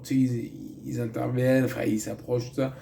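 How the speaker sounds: ordinary talk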